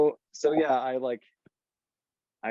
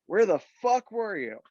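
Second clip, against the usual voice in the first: high, sharp angry demand; mock angry demand; 9 semitones higher than usual. Crew scolding the late sound guy, mock angry.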